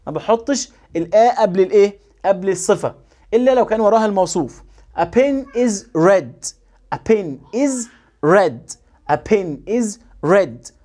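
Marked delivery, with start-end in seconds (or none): 5.0-10.7 slow, with a gap after each word; slow, separated reading drill; word-by-word model reading. Shown as a demonstration.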